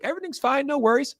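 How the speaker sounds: high, reassuring waiter voice; high and hurried, calming the guests; high nervous waiter. Retold or imitated.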